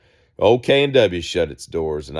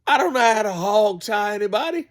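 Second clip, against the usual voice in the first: much higher, put-on mocking voice; mock R. Kelly voice. Quoting R. Kelly's interview line in a high mocking voice. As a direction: squeak it out like a caricature of him. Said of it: "he says".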